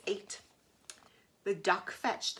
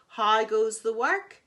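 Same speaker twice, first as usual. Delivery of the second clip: loud bossy farmer call; lazy farmer bellowing from bed; 9 dB louder than usual. Acted. Farmer calling, repeated.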